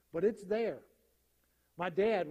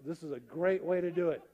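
ordinary talk

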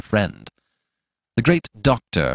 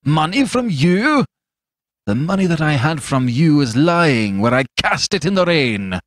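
indignant refusal; 6 semitones higher, 4 dB louder than usual. A narrator's reading. Fetz refusing the doctor's money, scornful fury. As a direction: fling the refusal at him.

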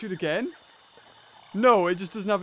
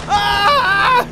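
ordinary delivery

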